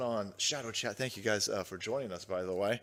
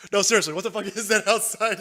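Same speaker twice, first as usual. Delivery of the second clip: ordinary delivery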